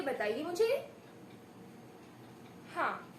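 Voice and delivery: ordinary talk, nothing marked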